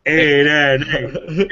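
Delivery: slow, stretched mock chant; drawn-out repeat. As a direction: drag out each word.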